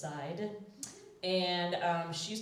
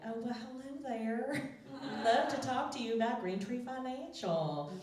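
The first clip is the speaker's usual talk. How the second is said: higher, lightly put-on Southern accent; matching Southern accent; 6 semitones higher than usual. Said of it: "if you match their accent and their cadence".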